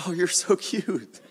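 chuckling, adoring tease; amused cooing God. Acted. God to anxious believers, amused chuckling coo. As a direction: laugh fondly through the words.

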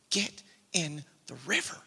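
low, hushed voice, spaced word by word; retold inner whisper. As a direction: imitate a quiet, insistent inner voice. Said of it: "this, like, whisper inside of me".

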